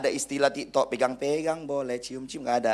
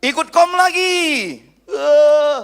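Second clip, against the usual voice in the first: much higher and louder, slowed exclamation; sudden loud outburst; 13 semitones higher, 12 dB louder, much slower than usual. Real, not acted. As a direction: burst out at the crowd.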